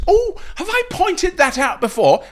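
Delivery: high, feigned surprise; mock innocence. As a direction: play innocent with an exaggerated high-pitched oh. Feigning surprise at repeating his point.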